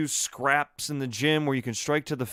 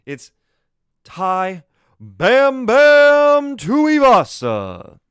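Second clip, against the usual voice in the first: much higher, much louder, drawn-out fight announcer call; ring announcer intro; 10 semitones higher, 13 dB louder, much slower than usual. Shown as a demonstration. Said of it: "Let's do it over for again".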